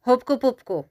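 mildly insistent objection; disagreeing. A narrator's reading. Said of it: "nesouhlasil s Žilkou Pilkou Kamínek Ramínek".

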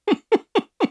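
high, light laugh. Real, not acted. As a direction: burst of high giggling. Laughing at the accent talk.

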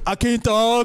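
tongue-tied mock mumble; teasing numb-tongue voice; mocking imitation. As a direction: imitate someone who can't get words out. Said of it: "he's over here going".

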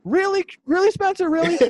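loud, very high-pitched incredulous challenge; exasperated disbelief. Real, not acted. Host scolds absent friend over his claim, exasperated disbelief. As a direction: call out your friend in sheer disbelief.

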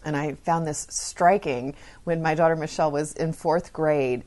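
ordinary talk

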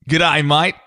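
Australian accent. Shown as a demonstration. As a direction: put on an Aussie accent. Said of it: "What does your Australian accent sound like?".

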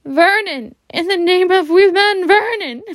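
high, loud panicked cry; shocked croak. Acted. Wife in shock calling to her husband. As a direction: gasp his name in horror. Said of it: "made a croaking sound".

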